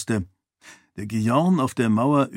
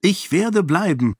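defiant determination; 7 semitones higher than usual. A narrator's reading. Sarene declaring to Raoden she will stay, defiant. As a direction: set your will against his. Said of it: "voll trotziger Entschlossenheit".

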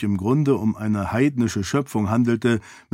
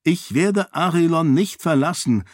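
higher, resolute refusal; determined; 7 semitones higher than usual. Acted. Daughter refuses to leave Arelon, firm and determined. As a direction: refuse outright, pitched up. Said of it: "sagte Sarene entschlossen".

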